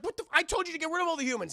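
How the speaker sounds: high, loud, rapid telling-off; outraged scolding. Retold or imitated. Demona scolding Puck over the humans, fast high outrage. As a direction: fire it off in mock outrage.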